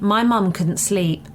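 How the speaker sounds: ordinary delivery, nothing marked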